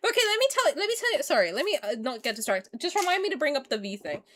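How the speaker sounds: ordinary talk, nothing marked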